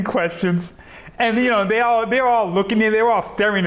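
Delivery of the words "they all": normal talking voice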